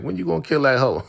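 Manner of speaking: high, quick and eager, egging her on; eager grandma. Retold or imitated. Grandma urging Uncle Clifford on, high and quick. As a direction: pitch it up and rush it like a gleeful granny.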